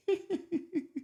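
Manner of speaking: a burst of high, delighted laughter. Real, not acted. Laughing at his daughter's cheeky five-dollar request.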